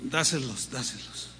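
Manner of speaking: quiet, low, fading insistence; hushed voice of the Lord urging; soft insistent repeat. Retold or imitated. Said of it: "Pues como que soltarle".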